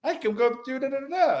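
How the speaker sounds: giddy buddy. Retold or imitated. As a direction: bounce it up like a giddy friend. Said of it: "And he's like".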